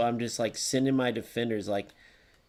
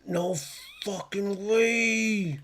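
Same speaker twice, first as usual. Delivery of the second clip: higher, very slow, stretched in disbelief; drawn-out disbelief; 7 semitones higher, much slower than usual. Real, not acted. Streamer stunned by a play, slow disbelief. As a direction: drag the words out like you can't believe it.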